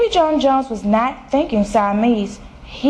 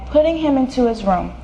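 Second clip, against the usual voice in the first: ordinary delivery, nothing marked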